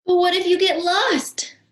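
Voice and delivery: worried Wallace. Acted. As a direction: fret about getting lost.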